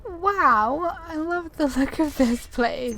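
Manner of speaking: thrilled awe at the scenery; amazed delight. Real, not acted. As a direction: gush over the place in real wonder. Player amazed by a new area.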